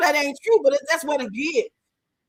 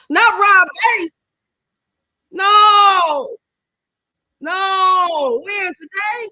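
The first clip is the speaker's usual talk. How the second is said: shocked disbelief; 5 semitones higher, 8 dB louder, much slower than usual. Real, not acted. Reacting to shocking death news.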